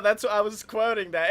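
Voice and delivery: ordinary talk, nothing marked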